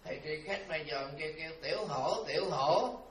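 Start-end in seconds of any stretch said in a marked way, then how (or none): 1.6-3.0 loud call across the temple yard. Acted. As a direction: call the dog's name out loud. Monk calls the dog over. (gọi to tên con chó)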